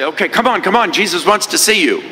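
high, hurried come-on; grudging apostles. Acted. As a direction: wave him over, now all eager. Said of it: "These Goombas finally say".

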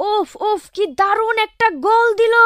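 high, loud, thrilled cheering; drunken excitement. Acted. Drunk fan cheers his mate about the six, high and excited.

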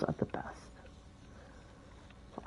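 soft unvoiced whisper about the glows; whispered aside. Real, not acted. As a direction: lean in and whisper it. Quiet aside while building.

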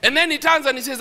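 usual speaking voice

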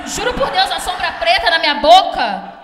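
loud, laughing disbelief; laughing loudly. Real, not acted. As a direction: laugh it out in horror. Laughing about a bad makeup job.

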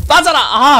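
high yelled command; raging gamer. Real, not acted. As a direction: yell it at the screen. Shouting at game.

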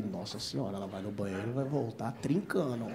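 hushed aroused inner voice; low eager murmur. Retold or imitated. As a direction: mutter it low, getting ideas. Aroused inner thought, much lower, softer.

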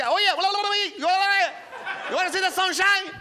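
high, loud mock Miami accent babble. Comic mimicking Miami locals' speech in a mock Miami accent. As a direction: gabble it like a fast Miami local. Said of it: "everyone's just like".

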